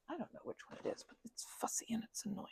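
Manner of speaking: hushed aside to the listener; whisper. Real, not acted. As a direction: breathe the words, no voice. ASMR chat slips into whisper.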